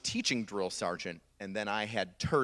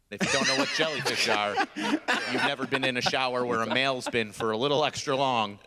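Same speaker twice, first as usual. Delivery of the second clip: ordinary talk, nothing marked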